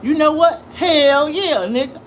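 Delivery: loud, high, emphatic comeback; riled-up shout. Acted. Riled answer to viewer's waxing question.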